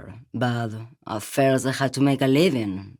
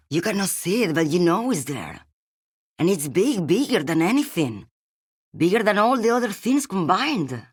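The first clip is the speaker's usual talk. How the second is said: higher-pitched, building wonder; awed intensity; 6 semitones higher than usual. Acted. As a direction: let the pitch climb with the sheer scale of it. Acted monologue, awed at something vast.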